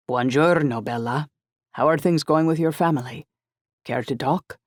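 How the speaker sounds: put-on Italian-accented friend checking in; rich Italian accent. A narrator's reading. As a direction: greet her in a smooth Italian accent. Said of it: "his rich Italian accent".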